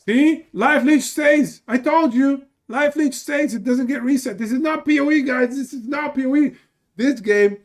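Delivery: gloating I-told-you-so shout; triumphant shouting. Real, not acted. Test proves him right.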